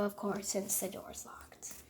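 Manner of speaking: soft breathy whisper; whispered aside. Pretend. Child explaining the pets sneak through the window, whispered aside. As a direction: whisper it like a secret.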